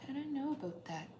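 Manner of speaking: softly attempted Canadian accent; soft, tentative Canadian impression. Shown as a demonstration. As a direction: try a quiet Canadian lilt. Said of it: "Can you do a Canadian accent?".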